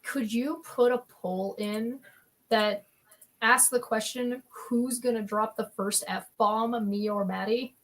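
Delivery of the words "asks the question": ordinary delivery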